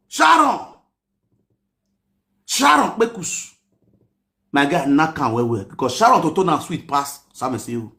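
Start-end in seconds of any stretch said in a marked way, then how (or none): 0.1-3.5 calling out. Real, not acted. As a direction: shout her name across. Calling out her name.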